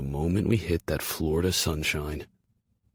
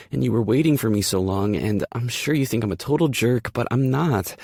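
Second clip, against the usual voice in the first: breathless rambling; 7 semitones higher, 5 dB louder than usual. Acted. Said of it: "The words only stop because she's out of air".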